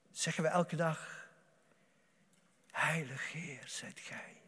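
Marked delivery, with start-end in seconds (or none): 2.7-4.4 soft unvoiced whisper of praise; whispered prayer. Preacher voices the daily prayer of worship, whispering. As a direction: breathe the words like a private prayer.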